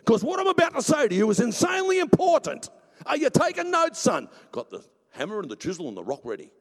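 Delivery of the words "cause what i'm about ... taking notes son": high, loud, urgent demand for attention; booming divine address. Acted. God tells Moses to take notes, loud and insistent. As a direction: holler it as an emphatic God.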